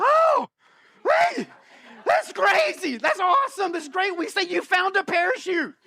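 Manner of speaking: high-pitched mock shout of relief; overjoyed relief. Skydiver as the parachute opens, hammed-up relieved elation. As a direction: burst out giddy, as if suddenly saved. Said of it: "all of a sudden, relief hits".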